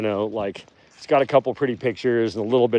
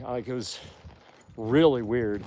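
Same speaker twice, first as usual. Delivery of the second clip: usual speaking voice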